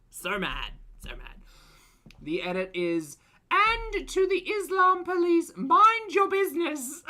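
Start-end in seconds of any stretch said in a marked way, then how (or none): 3.5-7.0 mock her like a shrieking online bully; foodie voice. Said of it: "in foodie voice".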